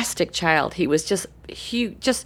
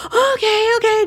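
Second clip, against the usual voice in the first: high, quick okay; eager little boy; 6 dB louder than usual. Retold or imitated. Boy agreeing to wait, high and eager. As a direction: agree like a bouncing kid.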